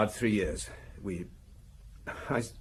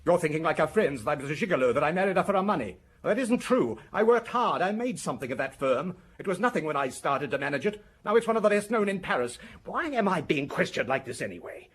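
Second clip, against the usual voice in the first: bitter outburst; 8 semitones higher, 3 dB louder than usual. Acted. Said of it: "You sound bitter about it".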